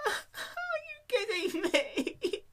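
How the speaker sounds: high, slow whine with a laugh; slow, high whining complaint. Acted. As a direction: whine in disbelief, half laughing. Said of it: "she whined".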